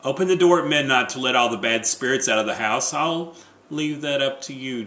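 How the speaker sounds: ordinary talk, nothing marked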